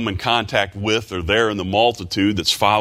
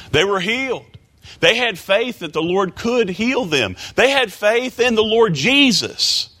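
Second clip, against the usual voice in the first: fervent preaching; 12 semitones higher than usual. Real, not acted. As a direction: hammer each not home. Preaching at full force.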